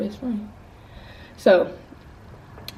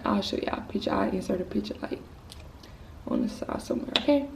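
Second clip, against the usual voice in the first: whispered aside; 11 dB quieter, faster than usual. Real, not acted.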